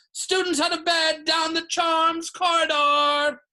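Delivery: sustained high-pitched full holler; bellowing. A narrator's reading. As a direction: sound the alarm, stretching the words out. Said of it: "Peeves bellowed".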